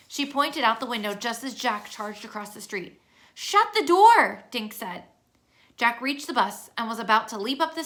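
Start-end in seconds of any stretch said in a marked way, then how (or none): 3.3-4.4 urgent command. A narrator's reading. Kids fleeing Jack onto bus.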